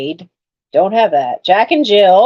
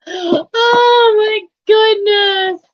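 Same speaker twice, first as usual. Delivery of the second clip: very high, drawn-out squeal of wonder; squeaky, stretched-out amazement; overwhelmed delight; 10 semitones higher, much slower than usual. Real, not acted. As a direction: swoon over it in a slow, high voice. Overwhelmed by the find.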